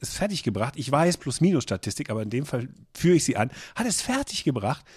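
ordinary talk, nothing marked

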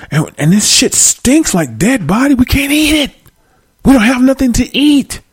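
high-pitched, worked-up dad rant; mad dad. Acted. Dad raging at family over spoiled meat, high and furious. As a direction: rant high and outraged as an angry father. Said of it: "dad gonna get mad and say".

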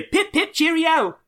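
very high, hammed-up British accent. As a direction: chirp it like a cartoon English toff. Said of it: "You doing your British accent".

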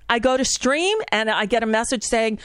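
ordinary delivery

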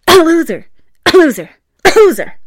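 high, loud sneezed "Loser" between coughs; cough-sneeze the word loser, loud. Retold or imitated. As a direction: fake sneeze hiding an insult. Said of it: "went for the full-on loser".

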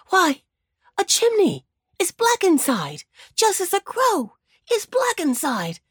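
put-on high, eager old rabbit; excited kindly old rabbit; Uncle Wiggily voice.

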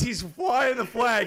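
high, slow mock proud call; hammed-up flag waving shout; a friend mocked for waving the flag, high shout. Mocking patriot switch.

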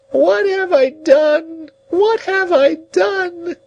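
high, very slow wail; despairing cry. A narrator's reading. Old woman on the ground lamenting, slow high wailing. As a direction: drag out each word in despair. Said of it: "returned to this cry over and over again".

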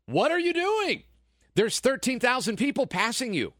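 high, incredulous mock outburst at a blocker; exasperated outburst. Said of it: "And you're gonna be like".

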